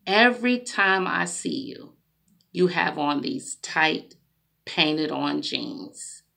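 retold soft word to the sister at the store; gentle regular voice. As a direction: speak to her kindly, not confronting. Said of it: "regular voice".

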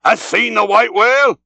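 high-pitched call to a passing ship; Ahab hailing. A narrator's reading. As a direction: cry the question out across the water. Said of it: "So cried Ahab, hailing a ship".

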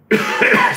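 harsh hacking cough; coughing fit. Real, not acted. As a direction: cough hard after a shot. Cough after drinking shot.

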